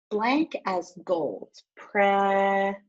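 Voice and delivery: slow, saying blank where the hard word is; slow reading with a gap. Shown as a demonstration. Teacher shows children how to skip a word, slowly. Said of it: "try skipping it".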